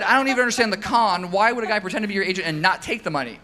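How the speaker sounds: baffled and amused, rushing it; incredulous laughing. Retold or imitated. Telling the interviewer how he reacted to the author, laughing and incredulous. Retold own confused reply, laughing.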